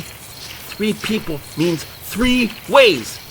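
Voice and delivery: slow, patronising over-explanation; slow explaining. Acted. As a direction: spell it out very slowly, as to a child. Explaining slowly to a dummy.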